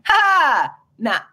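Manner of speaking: high, loud scoffing brush-off; dismissive scoff. Retold or imitated. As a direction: wave it away with a scoff. Voicing a dismissive quoted reaction.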